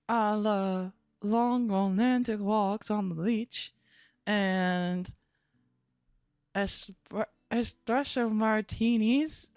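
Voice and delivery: slow, drawling Southern belle. Pretend. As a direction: draw the words out in a put-on Southern accent. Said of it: "She's a Southern belle".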